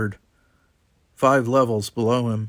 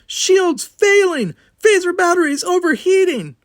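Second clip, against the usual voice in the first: alarmed shriek; 8 dB louder than usual. A narrator's reading. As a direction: shriek it in terror over the comlink. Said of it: "Tanya's alarmed shriek".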